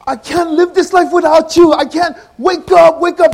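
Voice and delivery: high, loud desperate wail over the coffin; frantic wailing. Retold or imitated. Widow wailing over her husband's coffin, frantic and loud. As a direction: cry out to the dead husband, begging. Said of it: "the lady started freaking out".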